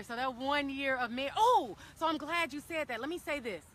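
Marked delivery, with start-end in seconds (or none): none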